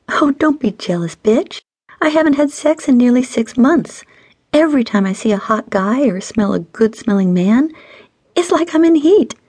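high, whiny mock pout; fake pout. A narrator's reading. Friend griping about six months without sex, mock pouting. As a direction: pout and complain, pitched well up. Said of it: "She faked a pout".

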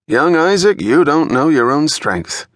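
put-on teasing old detective. A narrator's reading. Fulton teases young Isaac about his punch, mock-admiring. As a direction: rib the rookie like a grizzled partner.

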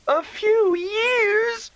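very high, long drawn-out wail. Acted. Sister hears he'll be gone for years, wails very high and drawn out. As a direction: stretch it into a child's howl of dismay. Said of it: "Oda Lee began to wail louder".

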